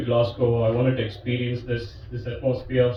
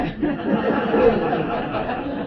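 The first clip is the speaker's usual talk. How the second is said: high laugh; 10 semitones higher than usual. Real, not acted. Laughs at own comeback.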